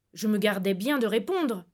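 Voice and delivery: ordinary delivery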